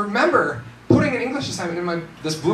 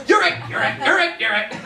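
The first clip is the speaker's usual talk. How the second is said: quick, excited playground calls; playful tag calls; 11 semitones higher than usual. Pretend. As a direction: tag them one after another. Acting out tag between classes.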